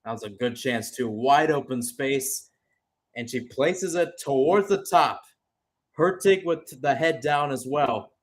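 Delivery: ordinary talk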